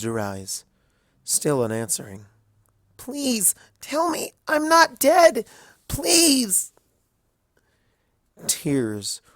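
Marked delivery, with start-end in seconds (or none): 3.0-6.7 very high, loud, slow desperate plea ending in a whisper; desperate begging. A narrator's reading. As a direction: beg with everything you have. Twilight begging, tears.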